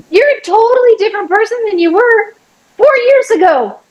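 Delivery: high, fast, teasing praise; playfully proud God. Retold or imitated. God praising her arms, high and teasing. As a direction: hype her up like a friend. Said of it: "And then God was like".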